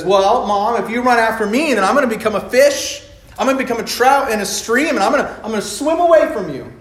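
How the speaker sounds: put-on a stubborn kid bunny daring mom; lightly voiced bunny, high and cheeky; defiant little bunny. Little bunny defies mother.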